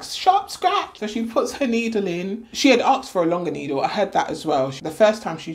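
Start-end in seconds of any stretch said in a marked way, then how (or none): 0.0-1.0 much higher, brisk nurse's warning; imitating the nurse. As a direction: chirp it like a nurse before a needle. Imitating the anaesthetist.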